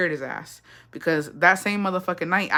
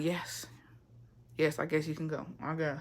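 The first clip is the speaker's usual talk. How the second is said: low, very soft, reluctant yes; small grudging voice; grudging consent; 4 semitones lower, 14 dB quieter than usual. Retold or imitated. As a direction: give in quietly, unenthused. Acts out grudgingly giving permission.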